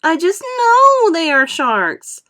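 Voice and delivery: cling to it in fear; panicky insistence. A narrator's reading.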